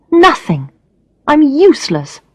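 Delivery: high, tight list of self-insults; bitter self-pity. Acted. Alex alone at night berating herself, high bitter self-pity. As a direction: spit each word out in despair.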